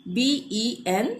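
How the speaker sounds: बी ई एन spelled slowly letter by letter; slow spelling. Shown as a demonstration. Teacher spelling the word for students, slow. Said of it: "spelling letters out".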